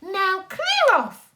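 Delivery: put-on high, slow, dismissive girl's voice. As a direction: shoo him off in a high little voice.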